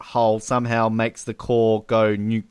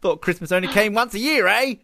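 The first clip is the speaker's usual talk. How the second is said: Canadian Bond; 7 semitones higher than usual. Retold or imitated. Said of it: "just turning Canadian".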